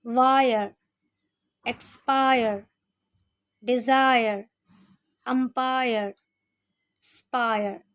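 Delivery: English -ire words said as "aayar"; वायर, एक्सपायर with the i as आया; example words read out. Shown as a demonstration. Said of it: "जैसे".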